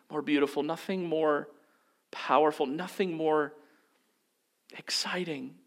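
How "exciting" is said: breathy whisper on exciting, softer. Real, not acted. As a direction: drop to an intense whisper on "exciting". Climax of sermon list.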